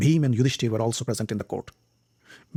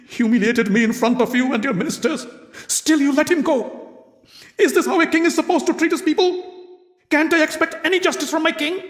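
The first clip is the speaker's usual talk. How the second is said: cracked, rising rage; 12 semitones higher than usual. A narrator's reading. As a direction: accuse the king, voice breaking into fury. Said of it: "With a cracked voice".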